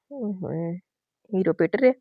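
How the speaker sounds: soft, half-swallowed mocking aside; teasing aside. A narrator's reading. Narrator teasing the beaten hero, soft aside. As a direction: murmur it as a sly joke.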